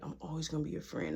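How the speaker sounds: quick, low reassurance; soft consoling murmur. Retold or imitated. Boyfriend reassures his crying ex, hushed and consoling. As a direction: murmur it as if into her ear. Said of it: "I'm just talking in her ear".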